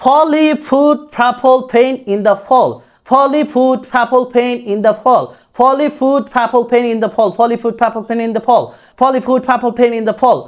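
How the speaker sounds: "Folly, foot" line chanted with every P turned to aspirated ফ. Shown as a demonstration. Teacher drilling a line repeatedly, P pronounced like ফ. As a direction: drill "ফলি ফুড ফ্যাপল" with breathed P onsets. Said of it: "প উচ্চারণ না করে ফর মতো করব".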